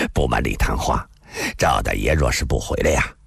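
low-pitched, ingratiating reply; humbly placating, pitched low; apologetic smile. A narrator's reading. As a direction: explain with a placating smile and a little sigh. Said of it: "一个人陪笑地说道".

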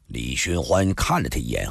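usual speaking voice

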